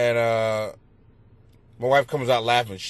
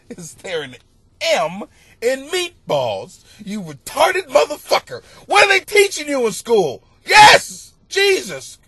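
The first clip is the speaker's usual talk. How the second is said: loud, high mock fury; exasperated rant; 10 semitones higher, 6 dB louder than usual. Comic father ranting about the kids, loud mock fury.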